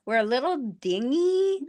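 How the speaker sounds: a light hint of Rose Nyland, high and airy; breezy naive Midwesterner, lightly voiced. A light hint of sweet naive Minnesotan Rose. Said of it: "Rose Nyland, Golden Girls".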